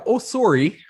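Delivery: high, loud Canadian "Oh, sorry"; chirpy Canadian apology; Canadian accent. Shown as a demonstration. Host showing a Canadian sorry. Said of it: "Say sorry in a Canadian accent".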